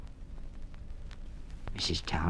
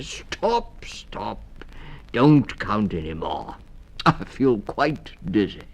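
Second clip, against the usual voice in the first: character voice; 4 semitones higher, 3 dB louder, slower than usual. Acted. Town Mouse overwhelmed.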